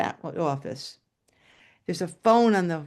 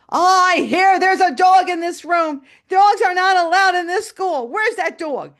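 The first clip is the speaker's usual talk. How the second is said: high, loud blustering boss voice; angry principal; 12 semitones higher, 8 dB louder than usual. Retold or imitated. Principal bursting into the classroom hunting the dog, loud bluster. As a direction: storm in barking about the dog. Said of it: "And he goes".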